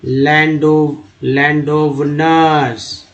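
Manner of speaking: each syllable drawn out slowly; slow reading demo. Shown as a demonstration. Teacher slowly reading words aloud to students. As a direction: sound out the words slowly for the class. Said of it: "ये है पढ़ने का actual तरीका".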